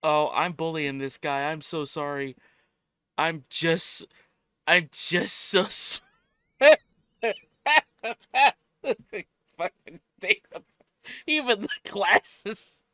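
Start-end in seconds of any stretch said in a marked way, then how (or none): 3.2-12.8 laughing. Real, not acted. Laughing fit continues.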